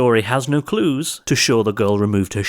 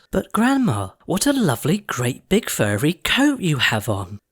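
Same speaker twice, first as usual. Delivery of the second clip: put-on little girl; 6 semitones higher than usual. Girl teases the wolf.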